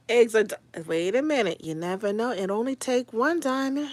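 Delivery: normal talking voice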